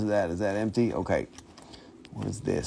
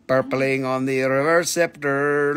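ordinary delivery

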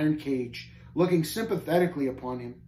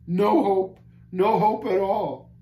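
loud, higher, hopeless groan; groaned despair; 5 semitones higher, 5 dB louder, slower than usual. A narrator's reading. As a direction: groan it out, drawing out the end. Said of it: "groaned he".

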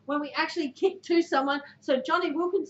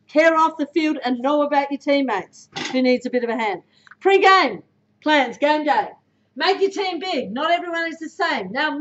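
ordinary talk, nothing marked

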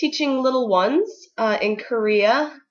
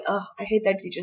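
put-on sulky teenager; 7 dB quieter, faster than usual. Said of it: "they're not going".

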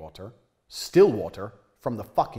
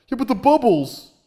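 much higher, loud squeaky mock; mocking whiny critic; 11 semitones higher, 10 dB louder than usual. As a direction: squeal it like a baby. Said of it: "he replied with the typical".

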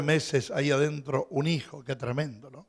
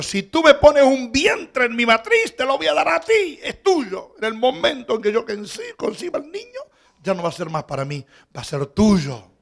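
fervent vow; 7 semitones higher, 7 dB louder than usual. Acted. Preacher voicing Hannah's vow.

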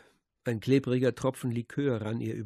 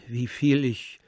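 plain reading voice

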